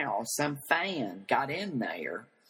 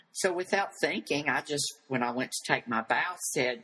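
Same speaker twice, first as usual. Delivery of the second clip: normal talking voice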